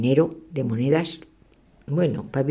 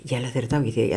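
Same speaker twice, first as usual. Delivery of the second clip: ordinary delivery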